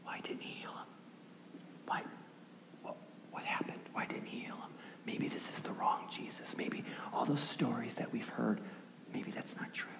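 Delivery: hushed doubting murmurs of a crowd; very quiet, breathy whispering; whispers. Acted. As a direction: whisper it as if passing doubts round the room. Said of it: "And then the whispers".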